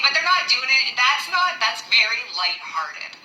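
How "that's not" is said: usual speaking voice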